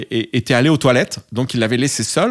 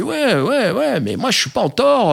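flustered; 10 semitones higher than usual. Retold or imitated. Defending himself to the tower.

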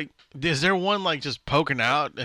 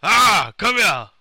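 Schwarzenegger voice; 5 semitones higher, 8 dB louder, slower than usual. Pretend. Arnold voice calling the horse.

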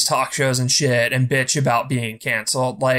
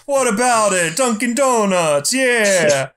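higher, louder mock Boston accent; 7 semitones higher, 4 dB louder than usual. Podcaster mocks a Boston comic, hammed-up Boston accent. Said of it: "his fucking, uh, Boston accent".

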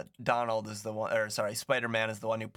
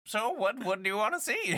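put-on high, quavering old lady; 9 semitones higher than usual. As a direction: play a fussy rich old woman. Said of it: "I did your voice".

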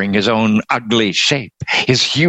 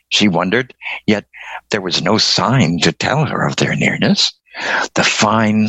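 ordinary delivery

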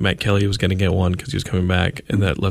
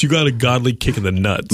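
ordinary delivery

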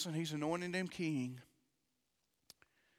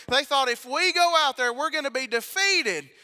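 soaring fearful cry; loud preaching; 7 semitones higher, 9 dB louder than usual. Acted. Preacher crying out the soldiers' fear. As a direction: shout out the soldiers' dread.

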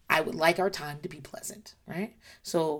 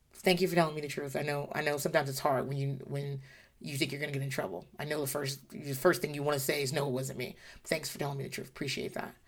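soft, gentle praise; tender thanks; 4 semitones lower, 8 dB quieter than usual. Retold or imitated. Mother to child who told the truth, soft and tender. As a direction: reassure the child quietly. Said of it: "thanking child for honesty".

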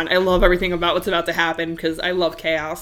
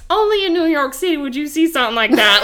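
imitating a Southern accent, higher and quick; Southern lady; 6 semitones higher, faster than usual. Tourist lady from Georgia marvelling, mock Southern accent. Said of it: "this, like, little Southern lady".

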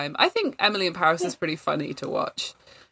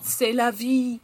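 slow, higher hammed-up French; mock French; 6 semitones higher, slower than usual. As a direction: lay on the cliché.

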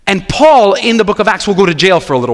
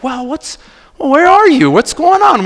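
surprised official; 8 semitones higher, 3 dB louder, slower than usual. Retold or imitated. Official surprised by pastor's request.

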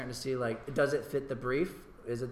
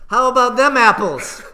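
much higher, loud gloating quote; movie quote; 11 semitones higher, 17 dB louder than usual. Retold or imitated. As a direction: crow it with a laugh. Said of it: "That's from an also movie, Good Will Hunting".

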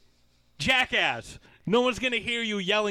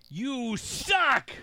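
higher, louder Red Sox fan jeer; mock heckle; 8 semitones higher, 4 dB louder than usual. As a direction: open with a Boston fan's jeer. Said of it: "he started off by going".

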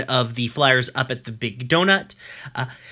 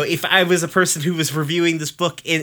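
ordinary talk

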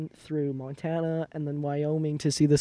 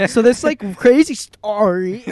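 mocking; 5 semitones higher, 12 dB louder, much slower than usual. Mocking friend fidgeting with cable.

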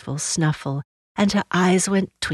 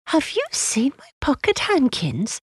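put-on high little-girl question; child's voice; 9 semitones higher than usual. Lucy asking after her lost handkerchiefs, high child's voice. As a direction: ask it high, like a small child.

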